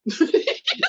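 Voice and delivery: bright high burst of laughter; laughing at cohost's joke. Real, not acted.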